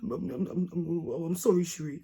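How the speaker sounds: mock stammered apology; lightly mocked pleading; begging boyfriend. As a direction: imitate him pleading and tripping over his words. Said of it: "was crying and begging me".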